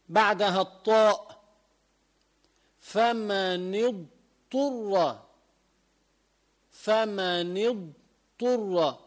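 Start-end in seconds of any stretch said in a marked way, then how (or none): 2.8-9.0 فمن اضطر slow, with the ض fully sounded before the ط; clear dad before ta. Shown as a demonstration. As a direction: draw out اضطر so the ض stays distinct from the ط. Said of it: "بده يلفظ ضاد بعدين طاء". (ضاد واضحة قبل الطاء في اضطر)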